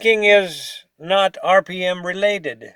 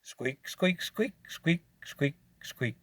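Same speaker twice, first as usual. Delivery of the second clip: squeak imitation; 14 dB quieter, slower than usual. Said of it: "just coast".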